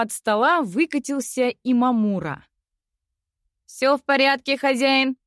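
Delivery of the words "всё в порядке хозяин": high, creaky robot servant; metallic robot voice. Acted. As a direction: scrape it out like a metal machine. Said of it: "проскрипел Имамура металлическим голосом".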